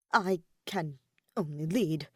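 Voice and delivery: usual speaking voice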